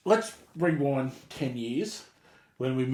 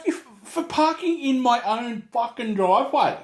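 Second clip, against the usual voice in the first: retold angry shout, raised pitch; angry outburst; 7 semitones higher, 3 dB louder than usual. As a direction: snap back in disbelief. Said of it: "please don't raise your voice or swear".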